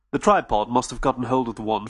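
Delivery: usual speaking voice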